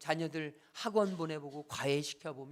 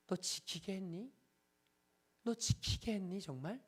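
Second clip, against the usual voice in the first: gently rebuking God; 3 semitones higher than usual. Retold or imitated. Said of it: "하나님이 제게 책망하시는 건".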